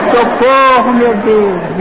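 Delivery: high-pitched mock yell for rescue; retold cry for help. Passenger retelling his screams for rescue, mock yell. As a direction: call to God in hammed-up panic. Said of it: "continuei a gritar e falei".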